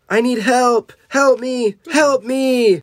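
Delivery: high acted pleading yell; high-pitched storybook panic; cry for help. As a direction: call out desperately for rescue. Trapped character calls for help.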